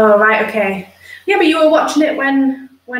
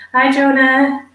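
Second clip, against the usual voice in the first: usual speaking voice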